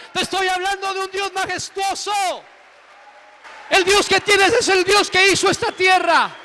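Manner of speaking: high, ringing sermon climax; full-force preaching. Real, not acted. Preacher proclaiming God's power to the congregation, full force.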